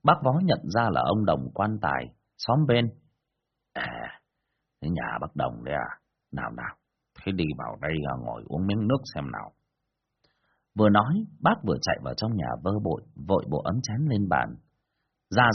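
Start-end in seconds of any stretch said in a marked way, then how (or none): 3.7-9.5 put-on much lower, softer old man's voice; lightly voiced old man. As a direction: greet the neighbour in a low, quiet voice. Bác Vó greets visitor, low.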